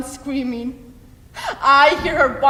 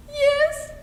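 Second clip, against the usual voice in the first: high, small, frightened answer; a child too scared to breathe; high frightened child's reply; terrified child; 11 semitones higher than usual. Acted. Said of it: "I say".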